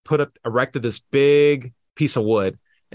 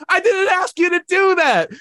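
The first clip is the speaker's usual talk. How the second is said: laughing exasperation. Retold or imitated. High, laughing retort to the neighbor.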